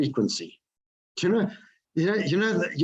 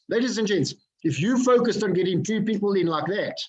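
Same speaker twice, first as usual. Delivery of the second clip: normal talking voice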